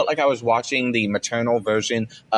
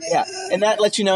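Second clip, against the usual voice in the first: ordinary delivery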